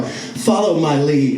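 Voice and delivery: low, slow, deadpan order; steady her like a stage veteran; mock serious command. Boy instructing his nervous partner, low and slow. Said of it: "I look at her, I go".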